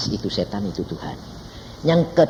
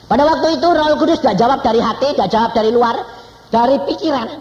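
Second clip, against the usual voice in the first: loud, high sermon voice; forceful preaching; 14 semitones higher, 12 dB louder than usual. Real, not acted. A preacher telling the congregation how the Spirit speaks, forcefully. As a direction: drive the point home to the crowd.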